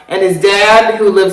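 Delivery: ordinary talk, nothing marked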